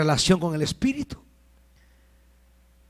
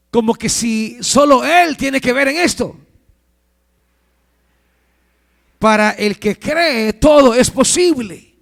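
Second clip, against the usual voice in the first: loud, raised preacher's voice; impassioned preaching; 7 semitones higher, 8 dB louder than usual. Acted. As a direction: drive it home to the congregation, high and loud. Preaches at full force.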